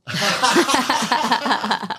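long, high-pitched peal of laughter. Real, not acted. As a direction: crack up and keep laughing. Reads a funny story, cracks up.

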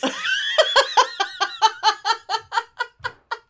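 high, loud burst of laughter; loud shrieking laugh. Real, not acted. Erupts laughing at the story.

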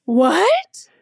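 high, loud gasped disbelief; stunned, can't believe it; shocked what. Real, not acted. Reacting to the chatbot's claim.

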